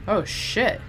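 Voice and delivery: ordinary delivery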